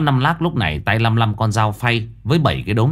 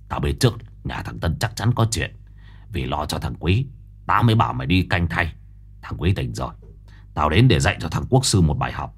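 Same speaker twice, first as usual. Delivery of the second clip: Năm Lác swearing revenge for his friend; gruff tough guy; 4 semitones lower than usual. Acted. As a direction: boast low and menacing. Năm Lác boasts his plan.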